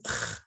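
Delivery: throaty scraped "ch" for the French R; gravelly French R. Shown as a demonstration. Teacher showing pupils the French R, gravelly ch. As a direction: make the R a rasp like ch in loch. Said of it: "It's kind of gravelly".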